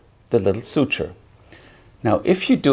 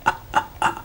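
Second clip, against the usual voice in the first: weak voiceless coughs on empty lungs; small dry repeated puffs; airless cough attempt; 4 dB quieter than usual. Shown as a demonstration. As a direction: try to cough with no air left. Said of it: "I can't cough".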